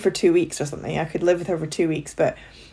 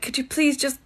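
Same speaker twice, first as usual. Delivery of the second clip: pleading; 9 semitones higher than usual. Pretend. Pleading with friend for strength.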